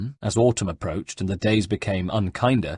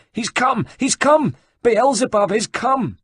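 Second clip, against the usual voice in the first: high, loud panicked screams; screaming in panic; 13 semitones higher, 5 dB louder, slower than usual. Acted. Terrified monks scream at the rooftop figure. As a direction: shriek it as the devil appears. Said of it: "they started to scream".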